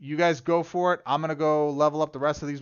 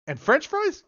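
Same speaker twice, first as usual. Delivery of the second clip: much higher, louder, teasing ask; high coaxing question; 7 dB louder than usual. Retold or imitated. Sister quizzing her bedridden dad, high and louder. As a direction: lift it up like coaxing a child.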